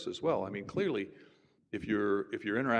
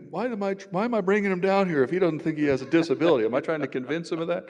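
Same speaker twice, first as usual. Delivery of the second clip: higher and louder, suddenly panicked; second-guess yourself in a rush of alarm; mock panic at his own plan; alarmed inner thought; 9 semitones higher, 10 dB louder than usual.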